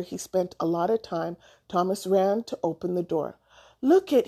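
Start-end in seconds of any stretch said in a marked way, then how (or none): 3.8-4.3 high, mild yell; lightly yelled, pitched up. A narrator's reading. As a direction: call out to show off a find. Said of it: "he yelled".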